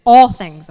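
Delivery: "All things" punched loud and falling; stress on all things. Real, not acted. Preacher to church, stressing "All things" loudly. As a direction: hammer "All things" home to the congregation. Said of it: "I cannot stress that word enough".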